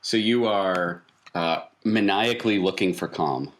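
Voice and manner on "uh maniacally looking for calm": ordinary delivery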